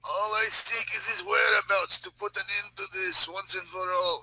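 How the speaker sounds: high, earnest character voice; insistent Russian character. Pretend. Russian explaining his quest to the sergeant, insistent.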